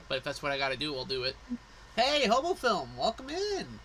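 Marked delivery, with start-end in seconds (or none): 2.0-3.8 high, slow welcome call; cheery greeting. Real, not acted. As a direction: greet them high and bright. Greeting a new viewer.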